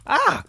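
sudden high loud yelp; startled yell. Real, not acted. Player yelping when attacked by an enemy. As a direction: yelp as you get hit.

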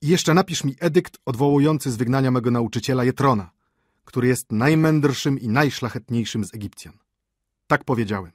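władczy książę. Acted. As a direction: proclaim decrees with regal force. Dictates royal edicts. (władczo, donośnym głosem)

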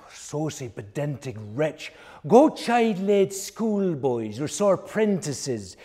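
usual speaking voice

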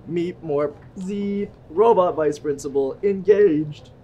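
stiff robot voice, slow and loud. Acted. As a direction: beep like a robot. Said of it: "do the robot voice".

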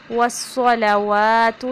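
والصلوات slow with a heavy sad and long wa; correct recitation. Shown as a demonstration. Teacher demonstrating والصلوات. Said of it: "صاد کو پر پڑھیں گے".

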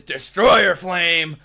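drawn-out, loud mimicked anime attack call; mock-dramatic dubbed battle cry; imitating the dub voice. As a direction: stretch DESTROYER slowly, pitch and volume climbing. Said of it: "I like the way he says".

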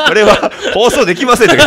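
high, loud, laughing through the words; barely getting words out between laughs; laughing hard. Real, not acted. Host cracking up after a failed impression.

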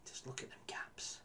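hushed whispered despair; a whispered groan at the gaps. Real, not acted. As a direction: breathe it out unvoiced in disbelief. Despairing at the defence.

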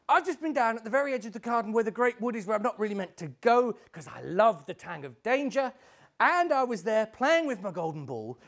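fast, high rush of explanation; young girl eagerly telling her tale; excited girl. Acted. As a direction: gabble out the adventure to your parents. Girl tells her parents her adventure.

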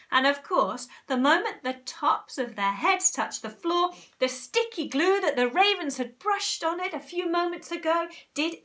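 put-on emphatic reading aloud to children; animated story narration. A narrator's reading. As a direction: build the list with mounting amazement.

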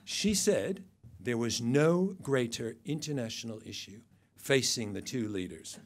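ordinary delivery

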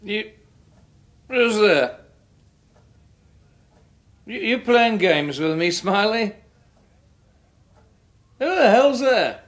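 calling out, growing alarmed; uneasy calling out. Acted. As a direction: call out, rattled by a noise. Alarmed by noise in house.

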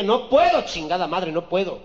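loud, high exasperated yell; exasperated refusal. Acted. Boyfriend losing patience with his girlfriend, yelling loudly. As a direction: blow up in frustration.

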